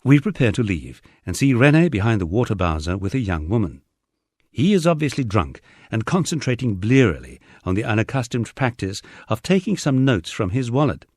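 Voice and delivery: ordinary delivery, nothing marked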